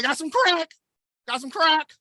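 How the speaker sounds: hint of a street dealer. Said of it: "whitest drug dealer".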